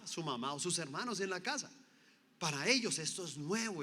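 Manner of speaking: ordinary delivery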